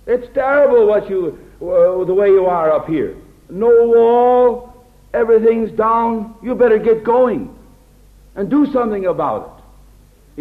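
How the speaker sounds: a blunt know-it-all rebuke; brash scolding. Pretend. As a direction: scold them like a bossy outsider. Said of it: "a man of God doesn't talk like that".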